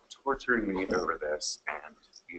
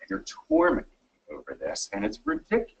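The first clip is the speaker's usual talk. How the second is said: staged client outrage; 12 semitones higher, 3 dB louder than usual. Acted. Actor plays a difficult client.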